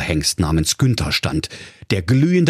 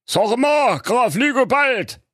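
king voice; slower than usual. Acted. King speaks to fake count.

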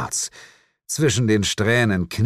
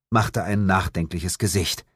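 usual speaking voice

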